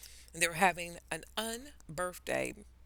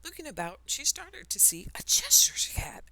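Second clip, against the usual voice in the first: ordinary delivery, nothing marked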